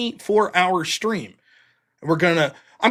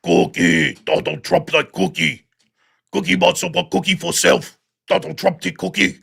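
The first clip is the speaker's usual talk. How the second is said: gruff mock Cookie Monster voice; hammed-up furry monster voice; 3 dB louder than usual. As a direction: growl it out like a hungry puppet. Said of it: "I can do Cookie Monster".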